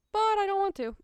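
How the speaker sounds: put-on high, loud childish protest; mock whining. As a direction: whine it out, playing up the reluctance. Acting out her earlier reluctance.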